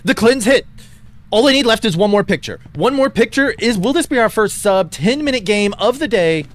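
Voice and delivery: loud, rising hype commentary; excited play-by-play. Acted. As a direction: call the finish like a sportscaster. Live play-by-play at the finish.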